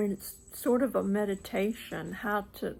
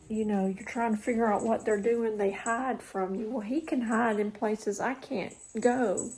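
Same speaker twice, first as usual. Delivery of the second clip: ordinary talk, nothing marked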